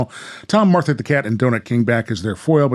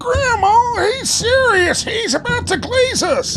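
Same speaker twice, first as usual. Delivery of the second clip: put-on much higher, alarmed boy voice; a frightened kid tugging at Grandma; panicked young Tom. Tom warns Grandma of the Donut King, high and alarmed.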